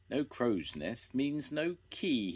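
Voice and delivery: a touch of disappointment, drawn out slowly; said sadly. A narrator's reading. Disappointed boy finding no key, mildly sad. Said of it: "he said sadly".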